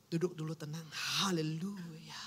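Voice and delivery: slow, soft whispered hallelujah; calming whisper. Pretend. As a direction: breathe it out to calm yourself. Calming oneself before replying. (bisikan pelan menenangkan diri)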